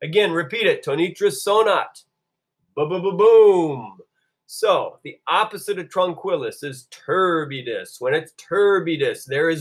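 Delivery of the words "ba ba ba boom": loud stuttering rumble into a long boom; imitated thunder boom. Shown as a demonstration. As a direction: roll out a playful thunderclap. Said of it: "it makes a noise".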